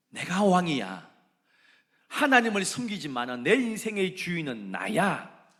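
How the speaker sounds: loud, high, arrogant declaration; proud king. Acted. King Jehu claiming his own rule, loud boast. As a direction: boast like a self-made king.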